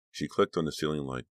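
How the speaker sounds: plain reading voice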